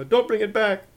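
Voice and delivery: high, very loud shriek; screamed. A narrator's reading. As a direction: scream it after him as Grandma. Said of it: "screamed Grandma".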